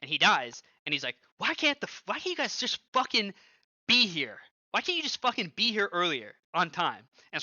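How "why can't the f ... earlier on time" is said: high, loud mock rage at teammates; strained high-pitched retold fury; imitating an angry rant. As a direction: reenact the teammate blowing up. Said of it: "but he was pissed".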